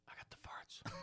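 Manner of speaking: put-on breathy whisper of a kid; whispered confession. A kid whispering his confession on stage. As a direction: whisper it like a guilty little boy.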